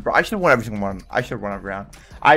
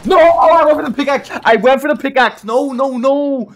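high, loud cry of dismay; anguished yelling; 11 semitones higher, 6 dB louder than usual. Real, not acted. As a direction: yell it in disbelief at the miss. Losing a duel, screaming in dismay.

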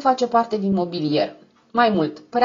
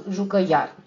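plain reading voice

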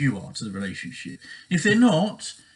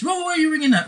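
much higher, accusing snap; a controlling partner catching you on the phone; suspicious challenge; 12 semitones higher, much faster than usual. Retold or imitated. Controlling partner questioning a call to family, accusing.

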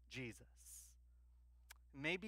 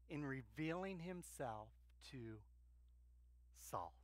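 tender preaching; 2 semitones lower, 10 dB quieter than usual. Acted.

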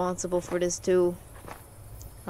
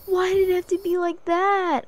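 loud, high, whining cry; distressed wail; 11 semitones higher, 7 dB louder than usual. Real, not acted. Player upset by game scare, distressed wail. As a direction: wail it in dismay.

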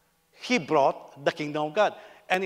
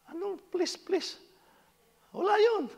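very high, mock-meek pleading. Mimicking a polite customer at a restaurant, high pleading. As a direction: squeak out an over-polite plea.

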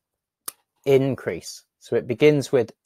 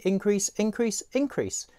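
each increase climbs higher in pitch; rising with each repeat; 7 semitones higher, 7 dB quieter than usual. Shown as a demonstration. Teacher showing increase by climbing pitch. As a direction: let the increases step upward. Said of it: "I can increase how many times I say increase".

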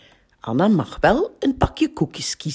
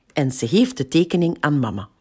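usual speaking voice

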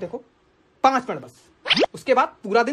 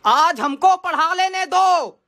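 higher-pitched fed-up rant; exasperated outburst; 4 semitones higher than usual. Acted. Harried teacher to interrupting family, exasperated outburst. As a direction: plead angrily to be left to teach.

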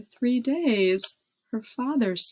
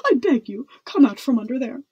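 louder, hurried plea; pleading cry; 2 semitones higher, 6 dB louder, faster than usual. A narrator's reading. Footman begging the princess to come out, crying out. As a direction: beg urgently, a little raised in voice. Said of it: "cried the head footman".

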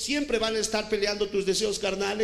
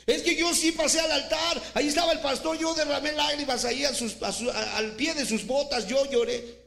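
mock pleading churchgoer; 8 semitones higher than usual. Preacher imitates a churchgoer boasting he cried at the altar, high whine. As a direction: plead your tears at the altar, hammed up.

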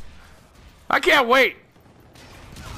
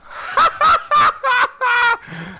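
high, rapid real cackle; helpless high-pitched laughing fit; big laugh; 13 semitones higher than usual. Real, not acted. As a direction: burst into long gleeful laughter. Delighted by own trick.

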